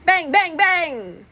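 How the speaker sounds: high rising bang sounds. A narrator's reading. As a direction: pop them off high.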